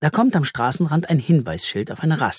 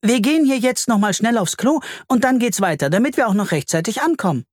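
hurrying mother; 9 semitones higher than usual. A narrator's reading. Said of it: "treibt alle zur Eile an".

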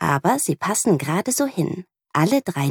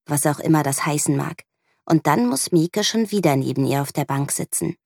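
usual speaking voice

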